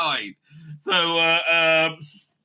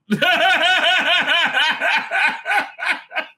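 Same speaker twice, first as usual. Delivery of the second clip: long, loud high cackle; real laughter; 9 semitones higher, 5 dB louder than usual. Real, not acted. Laughing at own story.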